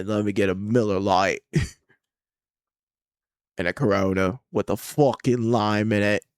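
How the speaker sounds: a loud put-on British bar order; British accent. Speaker at the bar ordering beers in a mock British accent. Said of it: "Only one speaking British".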